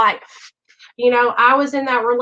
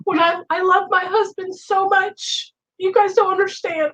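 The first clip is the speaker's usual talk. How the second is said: emotional; 6 semitones higher than usual. Real, not acted. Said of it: "I don't mean to get so emotional".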